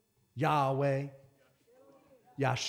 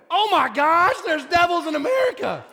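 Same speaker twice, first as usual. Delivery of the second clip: mock startled; 8 semitones higher, 4 dB louder than usual. Startled retold reaction.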